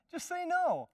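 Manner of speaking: high, laughing through the repeat. Acted. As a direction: laugh while you insist on no.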